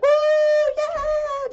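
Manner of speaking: very high, loud squealing cheer; celebrating whoop. Retold or imitated. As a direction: whoop like you just won the lottery. Mom celebrating breakup like lottery win.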